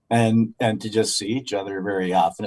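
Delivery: ordinary talk, nothing marked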